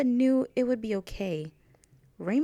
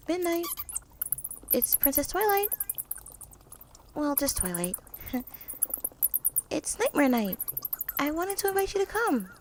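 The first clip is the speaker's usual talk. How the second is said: high, hopeful calling voice; 8 semitones higher than usual. Acted. Twilight calling for Ruby in the dark castle, high and hopeful. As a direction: call into the empty halls, bright and high.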